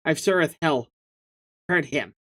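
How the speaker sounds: ordinary delivery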